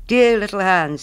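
miller's hearty, coaxing hail; calling out. Acted. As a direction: call to a friend over the wall. Miller hails Hans from road.

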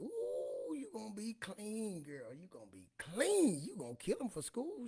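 high, slow, teasing hype; big up the girl's new clothes; mock-flattering high cheer to the sister-in-law; hyped-up praise. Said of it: "I said".

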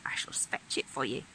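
soft secret whisper. A narrator's reading.

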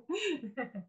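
a soft trailing laugh. Real, not acted. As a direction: chuckle quietly to yourself. Laughs after her remark.

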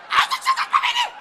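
high shrieking yell; exasperated shout. Real, not acted. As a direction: scream at her in frustration.

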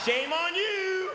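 high, drawn-out mock scolding. Comic shaming the guy eating a potato, high drawn-out scold. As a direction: draw it out high, shaming them for laughs.

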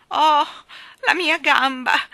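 loud, high, drawn-out wail; crying out in pain. A narrator's reading. The maid fallen on the stairs, crying out in pain. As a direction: howl it slowly. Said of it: "gridava Ellen".

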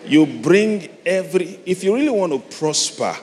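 loud, high fervent preaching; full-force preaching. Acted. Preacher urging the congregation, loud and fervent.

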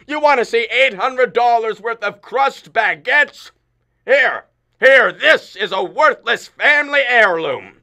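high, loud mocking shout; father yelling sarcastically at his daughter; sarcastic yell. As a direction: taunt her through the door.

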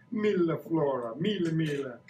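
playful mock-Italian lilt; fake Italian accent. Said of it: "use my fake Italian accent".

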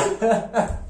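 a quick breathy laugh breaking out; short laugh. Real, not acted. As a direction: let a laugh slip as the prank drops. Husband reveals the prank.

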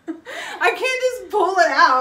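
high, loud, laughing through the words. Real, not acted. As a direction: protest while cracking up. Laughs at accent request.

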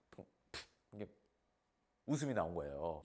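usual speaking voice

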